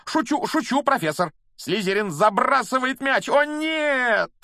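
excited high-pitched play-by-play, building to a loud wail; sports commentator getting carried away; match commentator. A narrator's reading. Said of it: "Ли продолжал комментировать игру".

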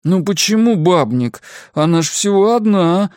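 aggrieved, pleading whine; whining protest. A narrator's reading. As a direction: whine that it is unfair. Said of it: "заныл я".

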